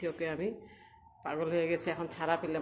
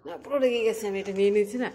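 higher, louder gleeful teasing; excited teasing; 4 semitones higher, 7 dB louder than usual. Real, not acted. Owner teasing Koko after taking her toy, excited and loud. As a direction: crow over taking the toy away.

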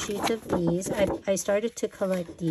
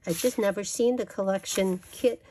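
ordinary talk

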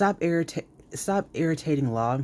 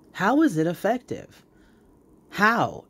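incredulous challenge; 7 semitones higher, 4 dB louder than usual. Real, not acted. Ranting at a YouTuber's claim.

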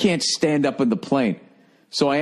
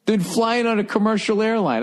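mock inner voice; 10 semitones higher than usual. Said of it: "I'm just thinking, like".

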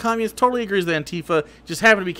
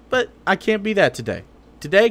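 ordinary talk, nothing marked

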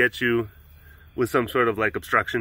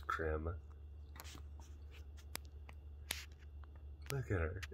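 much softer, low murmur; hushed; 7 semitones lower, 23 dB quieter than usual. Real, not acted. Owner filming her cat in bed, murmuring softly. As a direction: murmur so you don't wake her.

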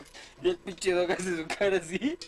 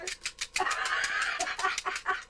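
hysterical laugh; 9 semitones higher, 3 dB quieter than usual. Real, not acted. Cracking up at friend.

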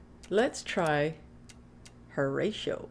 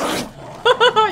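burst of laughter; 14 semitones higher, 6 dB louder than usual. Real, not acted.

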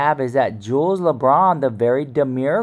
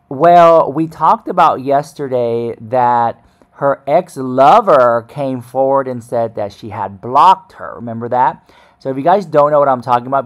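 louder, punchy host announcement voice; loud vlog update; 6 dB louder than usual. Acted. Host opening an update video for viewers, loud and punchy.

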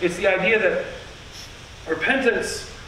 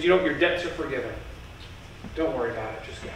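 much softer, lower and gentle; merciful king; 6 semitones lower, 7 dB quieter, much faster than usual. Retold or imitated. The king forgiving the debtor, soft and merciful. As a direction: let him off softly, full of mercy. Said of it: "he had mercy, he had grace".

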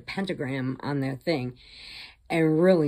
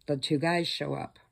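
normal talking voice

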